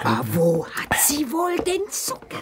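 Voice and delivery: unvoiced whispered aside. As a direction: hush it to yourself. Witch muttering to herself.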